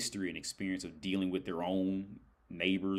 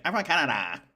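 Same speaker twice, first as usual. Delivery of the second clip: high, mock Canadian "Canada" with a flat a; imitating her Canadian accent, hammed up; 8 semitones higher, 6 dB louder than usual. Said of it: "you do not say Canada, like Canada".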